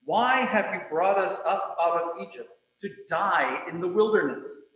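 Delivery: ordinary talk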